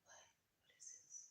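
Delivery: breathless whispered peek around the corner; curious little page whispering. Pretend. Reader voicing the peeking page card, a whisper. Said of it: "being very, uh, watchful, like".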